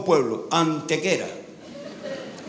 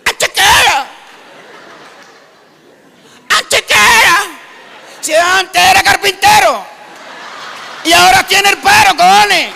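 high, loud, ever more frantic English tourist; exasperated tourist; 13 semitones higher, 10 dB louder, slower than usual. Retold or imitated. Comedian voices a frustrated English tourist shouting at the Andalusian farmer. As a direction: shout the town name louder each time. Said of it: "turista al andaluz".

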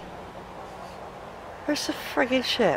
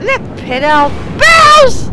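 drawn-out high yell; loud slow exclamation; 10 semitones higher, 11 dB louder, slower than usual. Real, not acted. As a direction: bellow it slowly in shock. Hit in game.

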